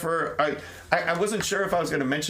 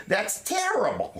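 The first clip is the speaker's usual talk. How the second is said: a slow, slightly higher, lightly voiced Joker; mock Joker voice saying "That's terrible" slowly; bad Joker impression; 5 semitones higher, slower than usual. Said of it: "That was my terrible impression of the Joker".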